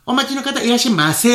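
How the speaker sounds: high sing-song clerk greeting; bright loud shop welcome. Retold or imitated. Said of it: "イントネーションずっと".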